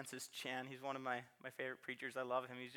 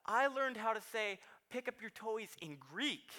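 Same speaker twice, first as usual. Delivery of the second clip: put-on high, loud toddler voice, eager; small boy boasting to daddy; excited little boy; 8 semitones higher, 4 dB louder than usual. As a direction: gush it like a kid with big news. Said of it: "he's like".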